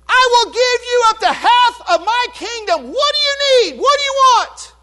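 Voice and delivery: booming, high-pitched kingly generosity; generous king. Retold or imitated. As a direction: grandly offer her anything. King offers Esther half kingdom.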